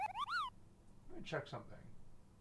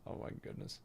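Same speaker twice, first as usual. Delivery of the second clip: whispered; 22 dB quieter, faster than usual. Real, not acted. Shocked aside.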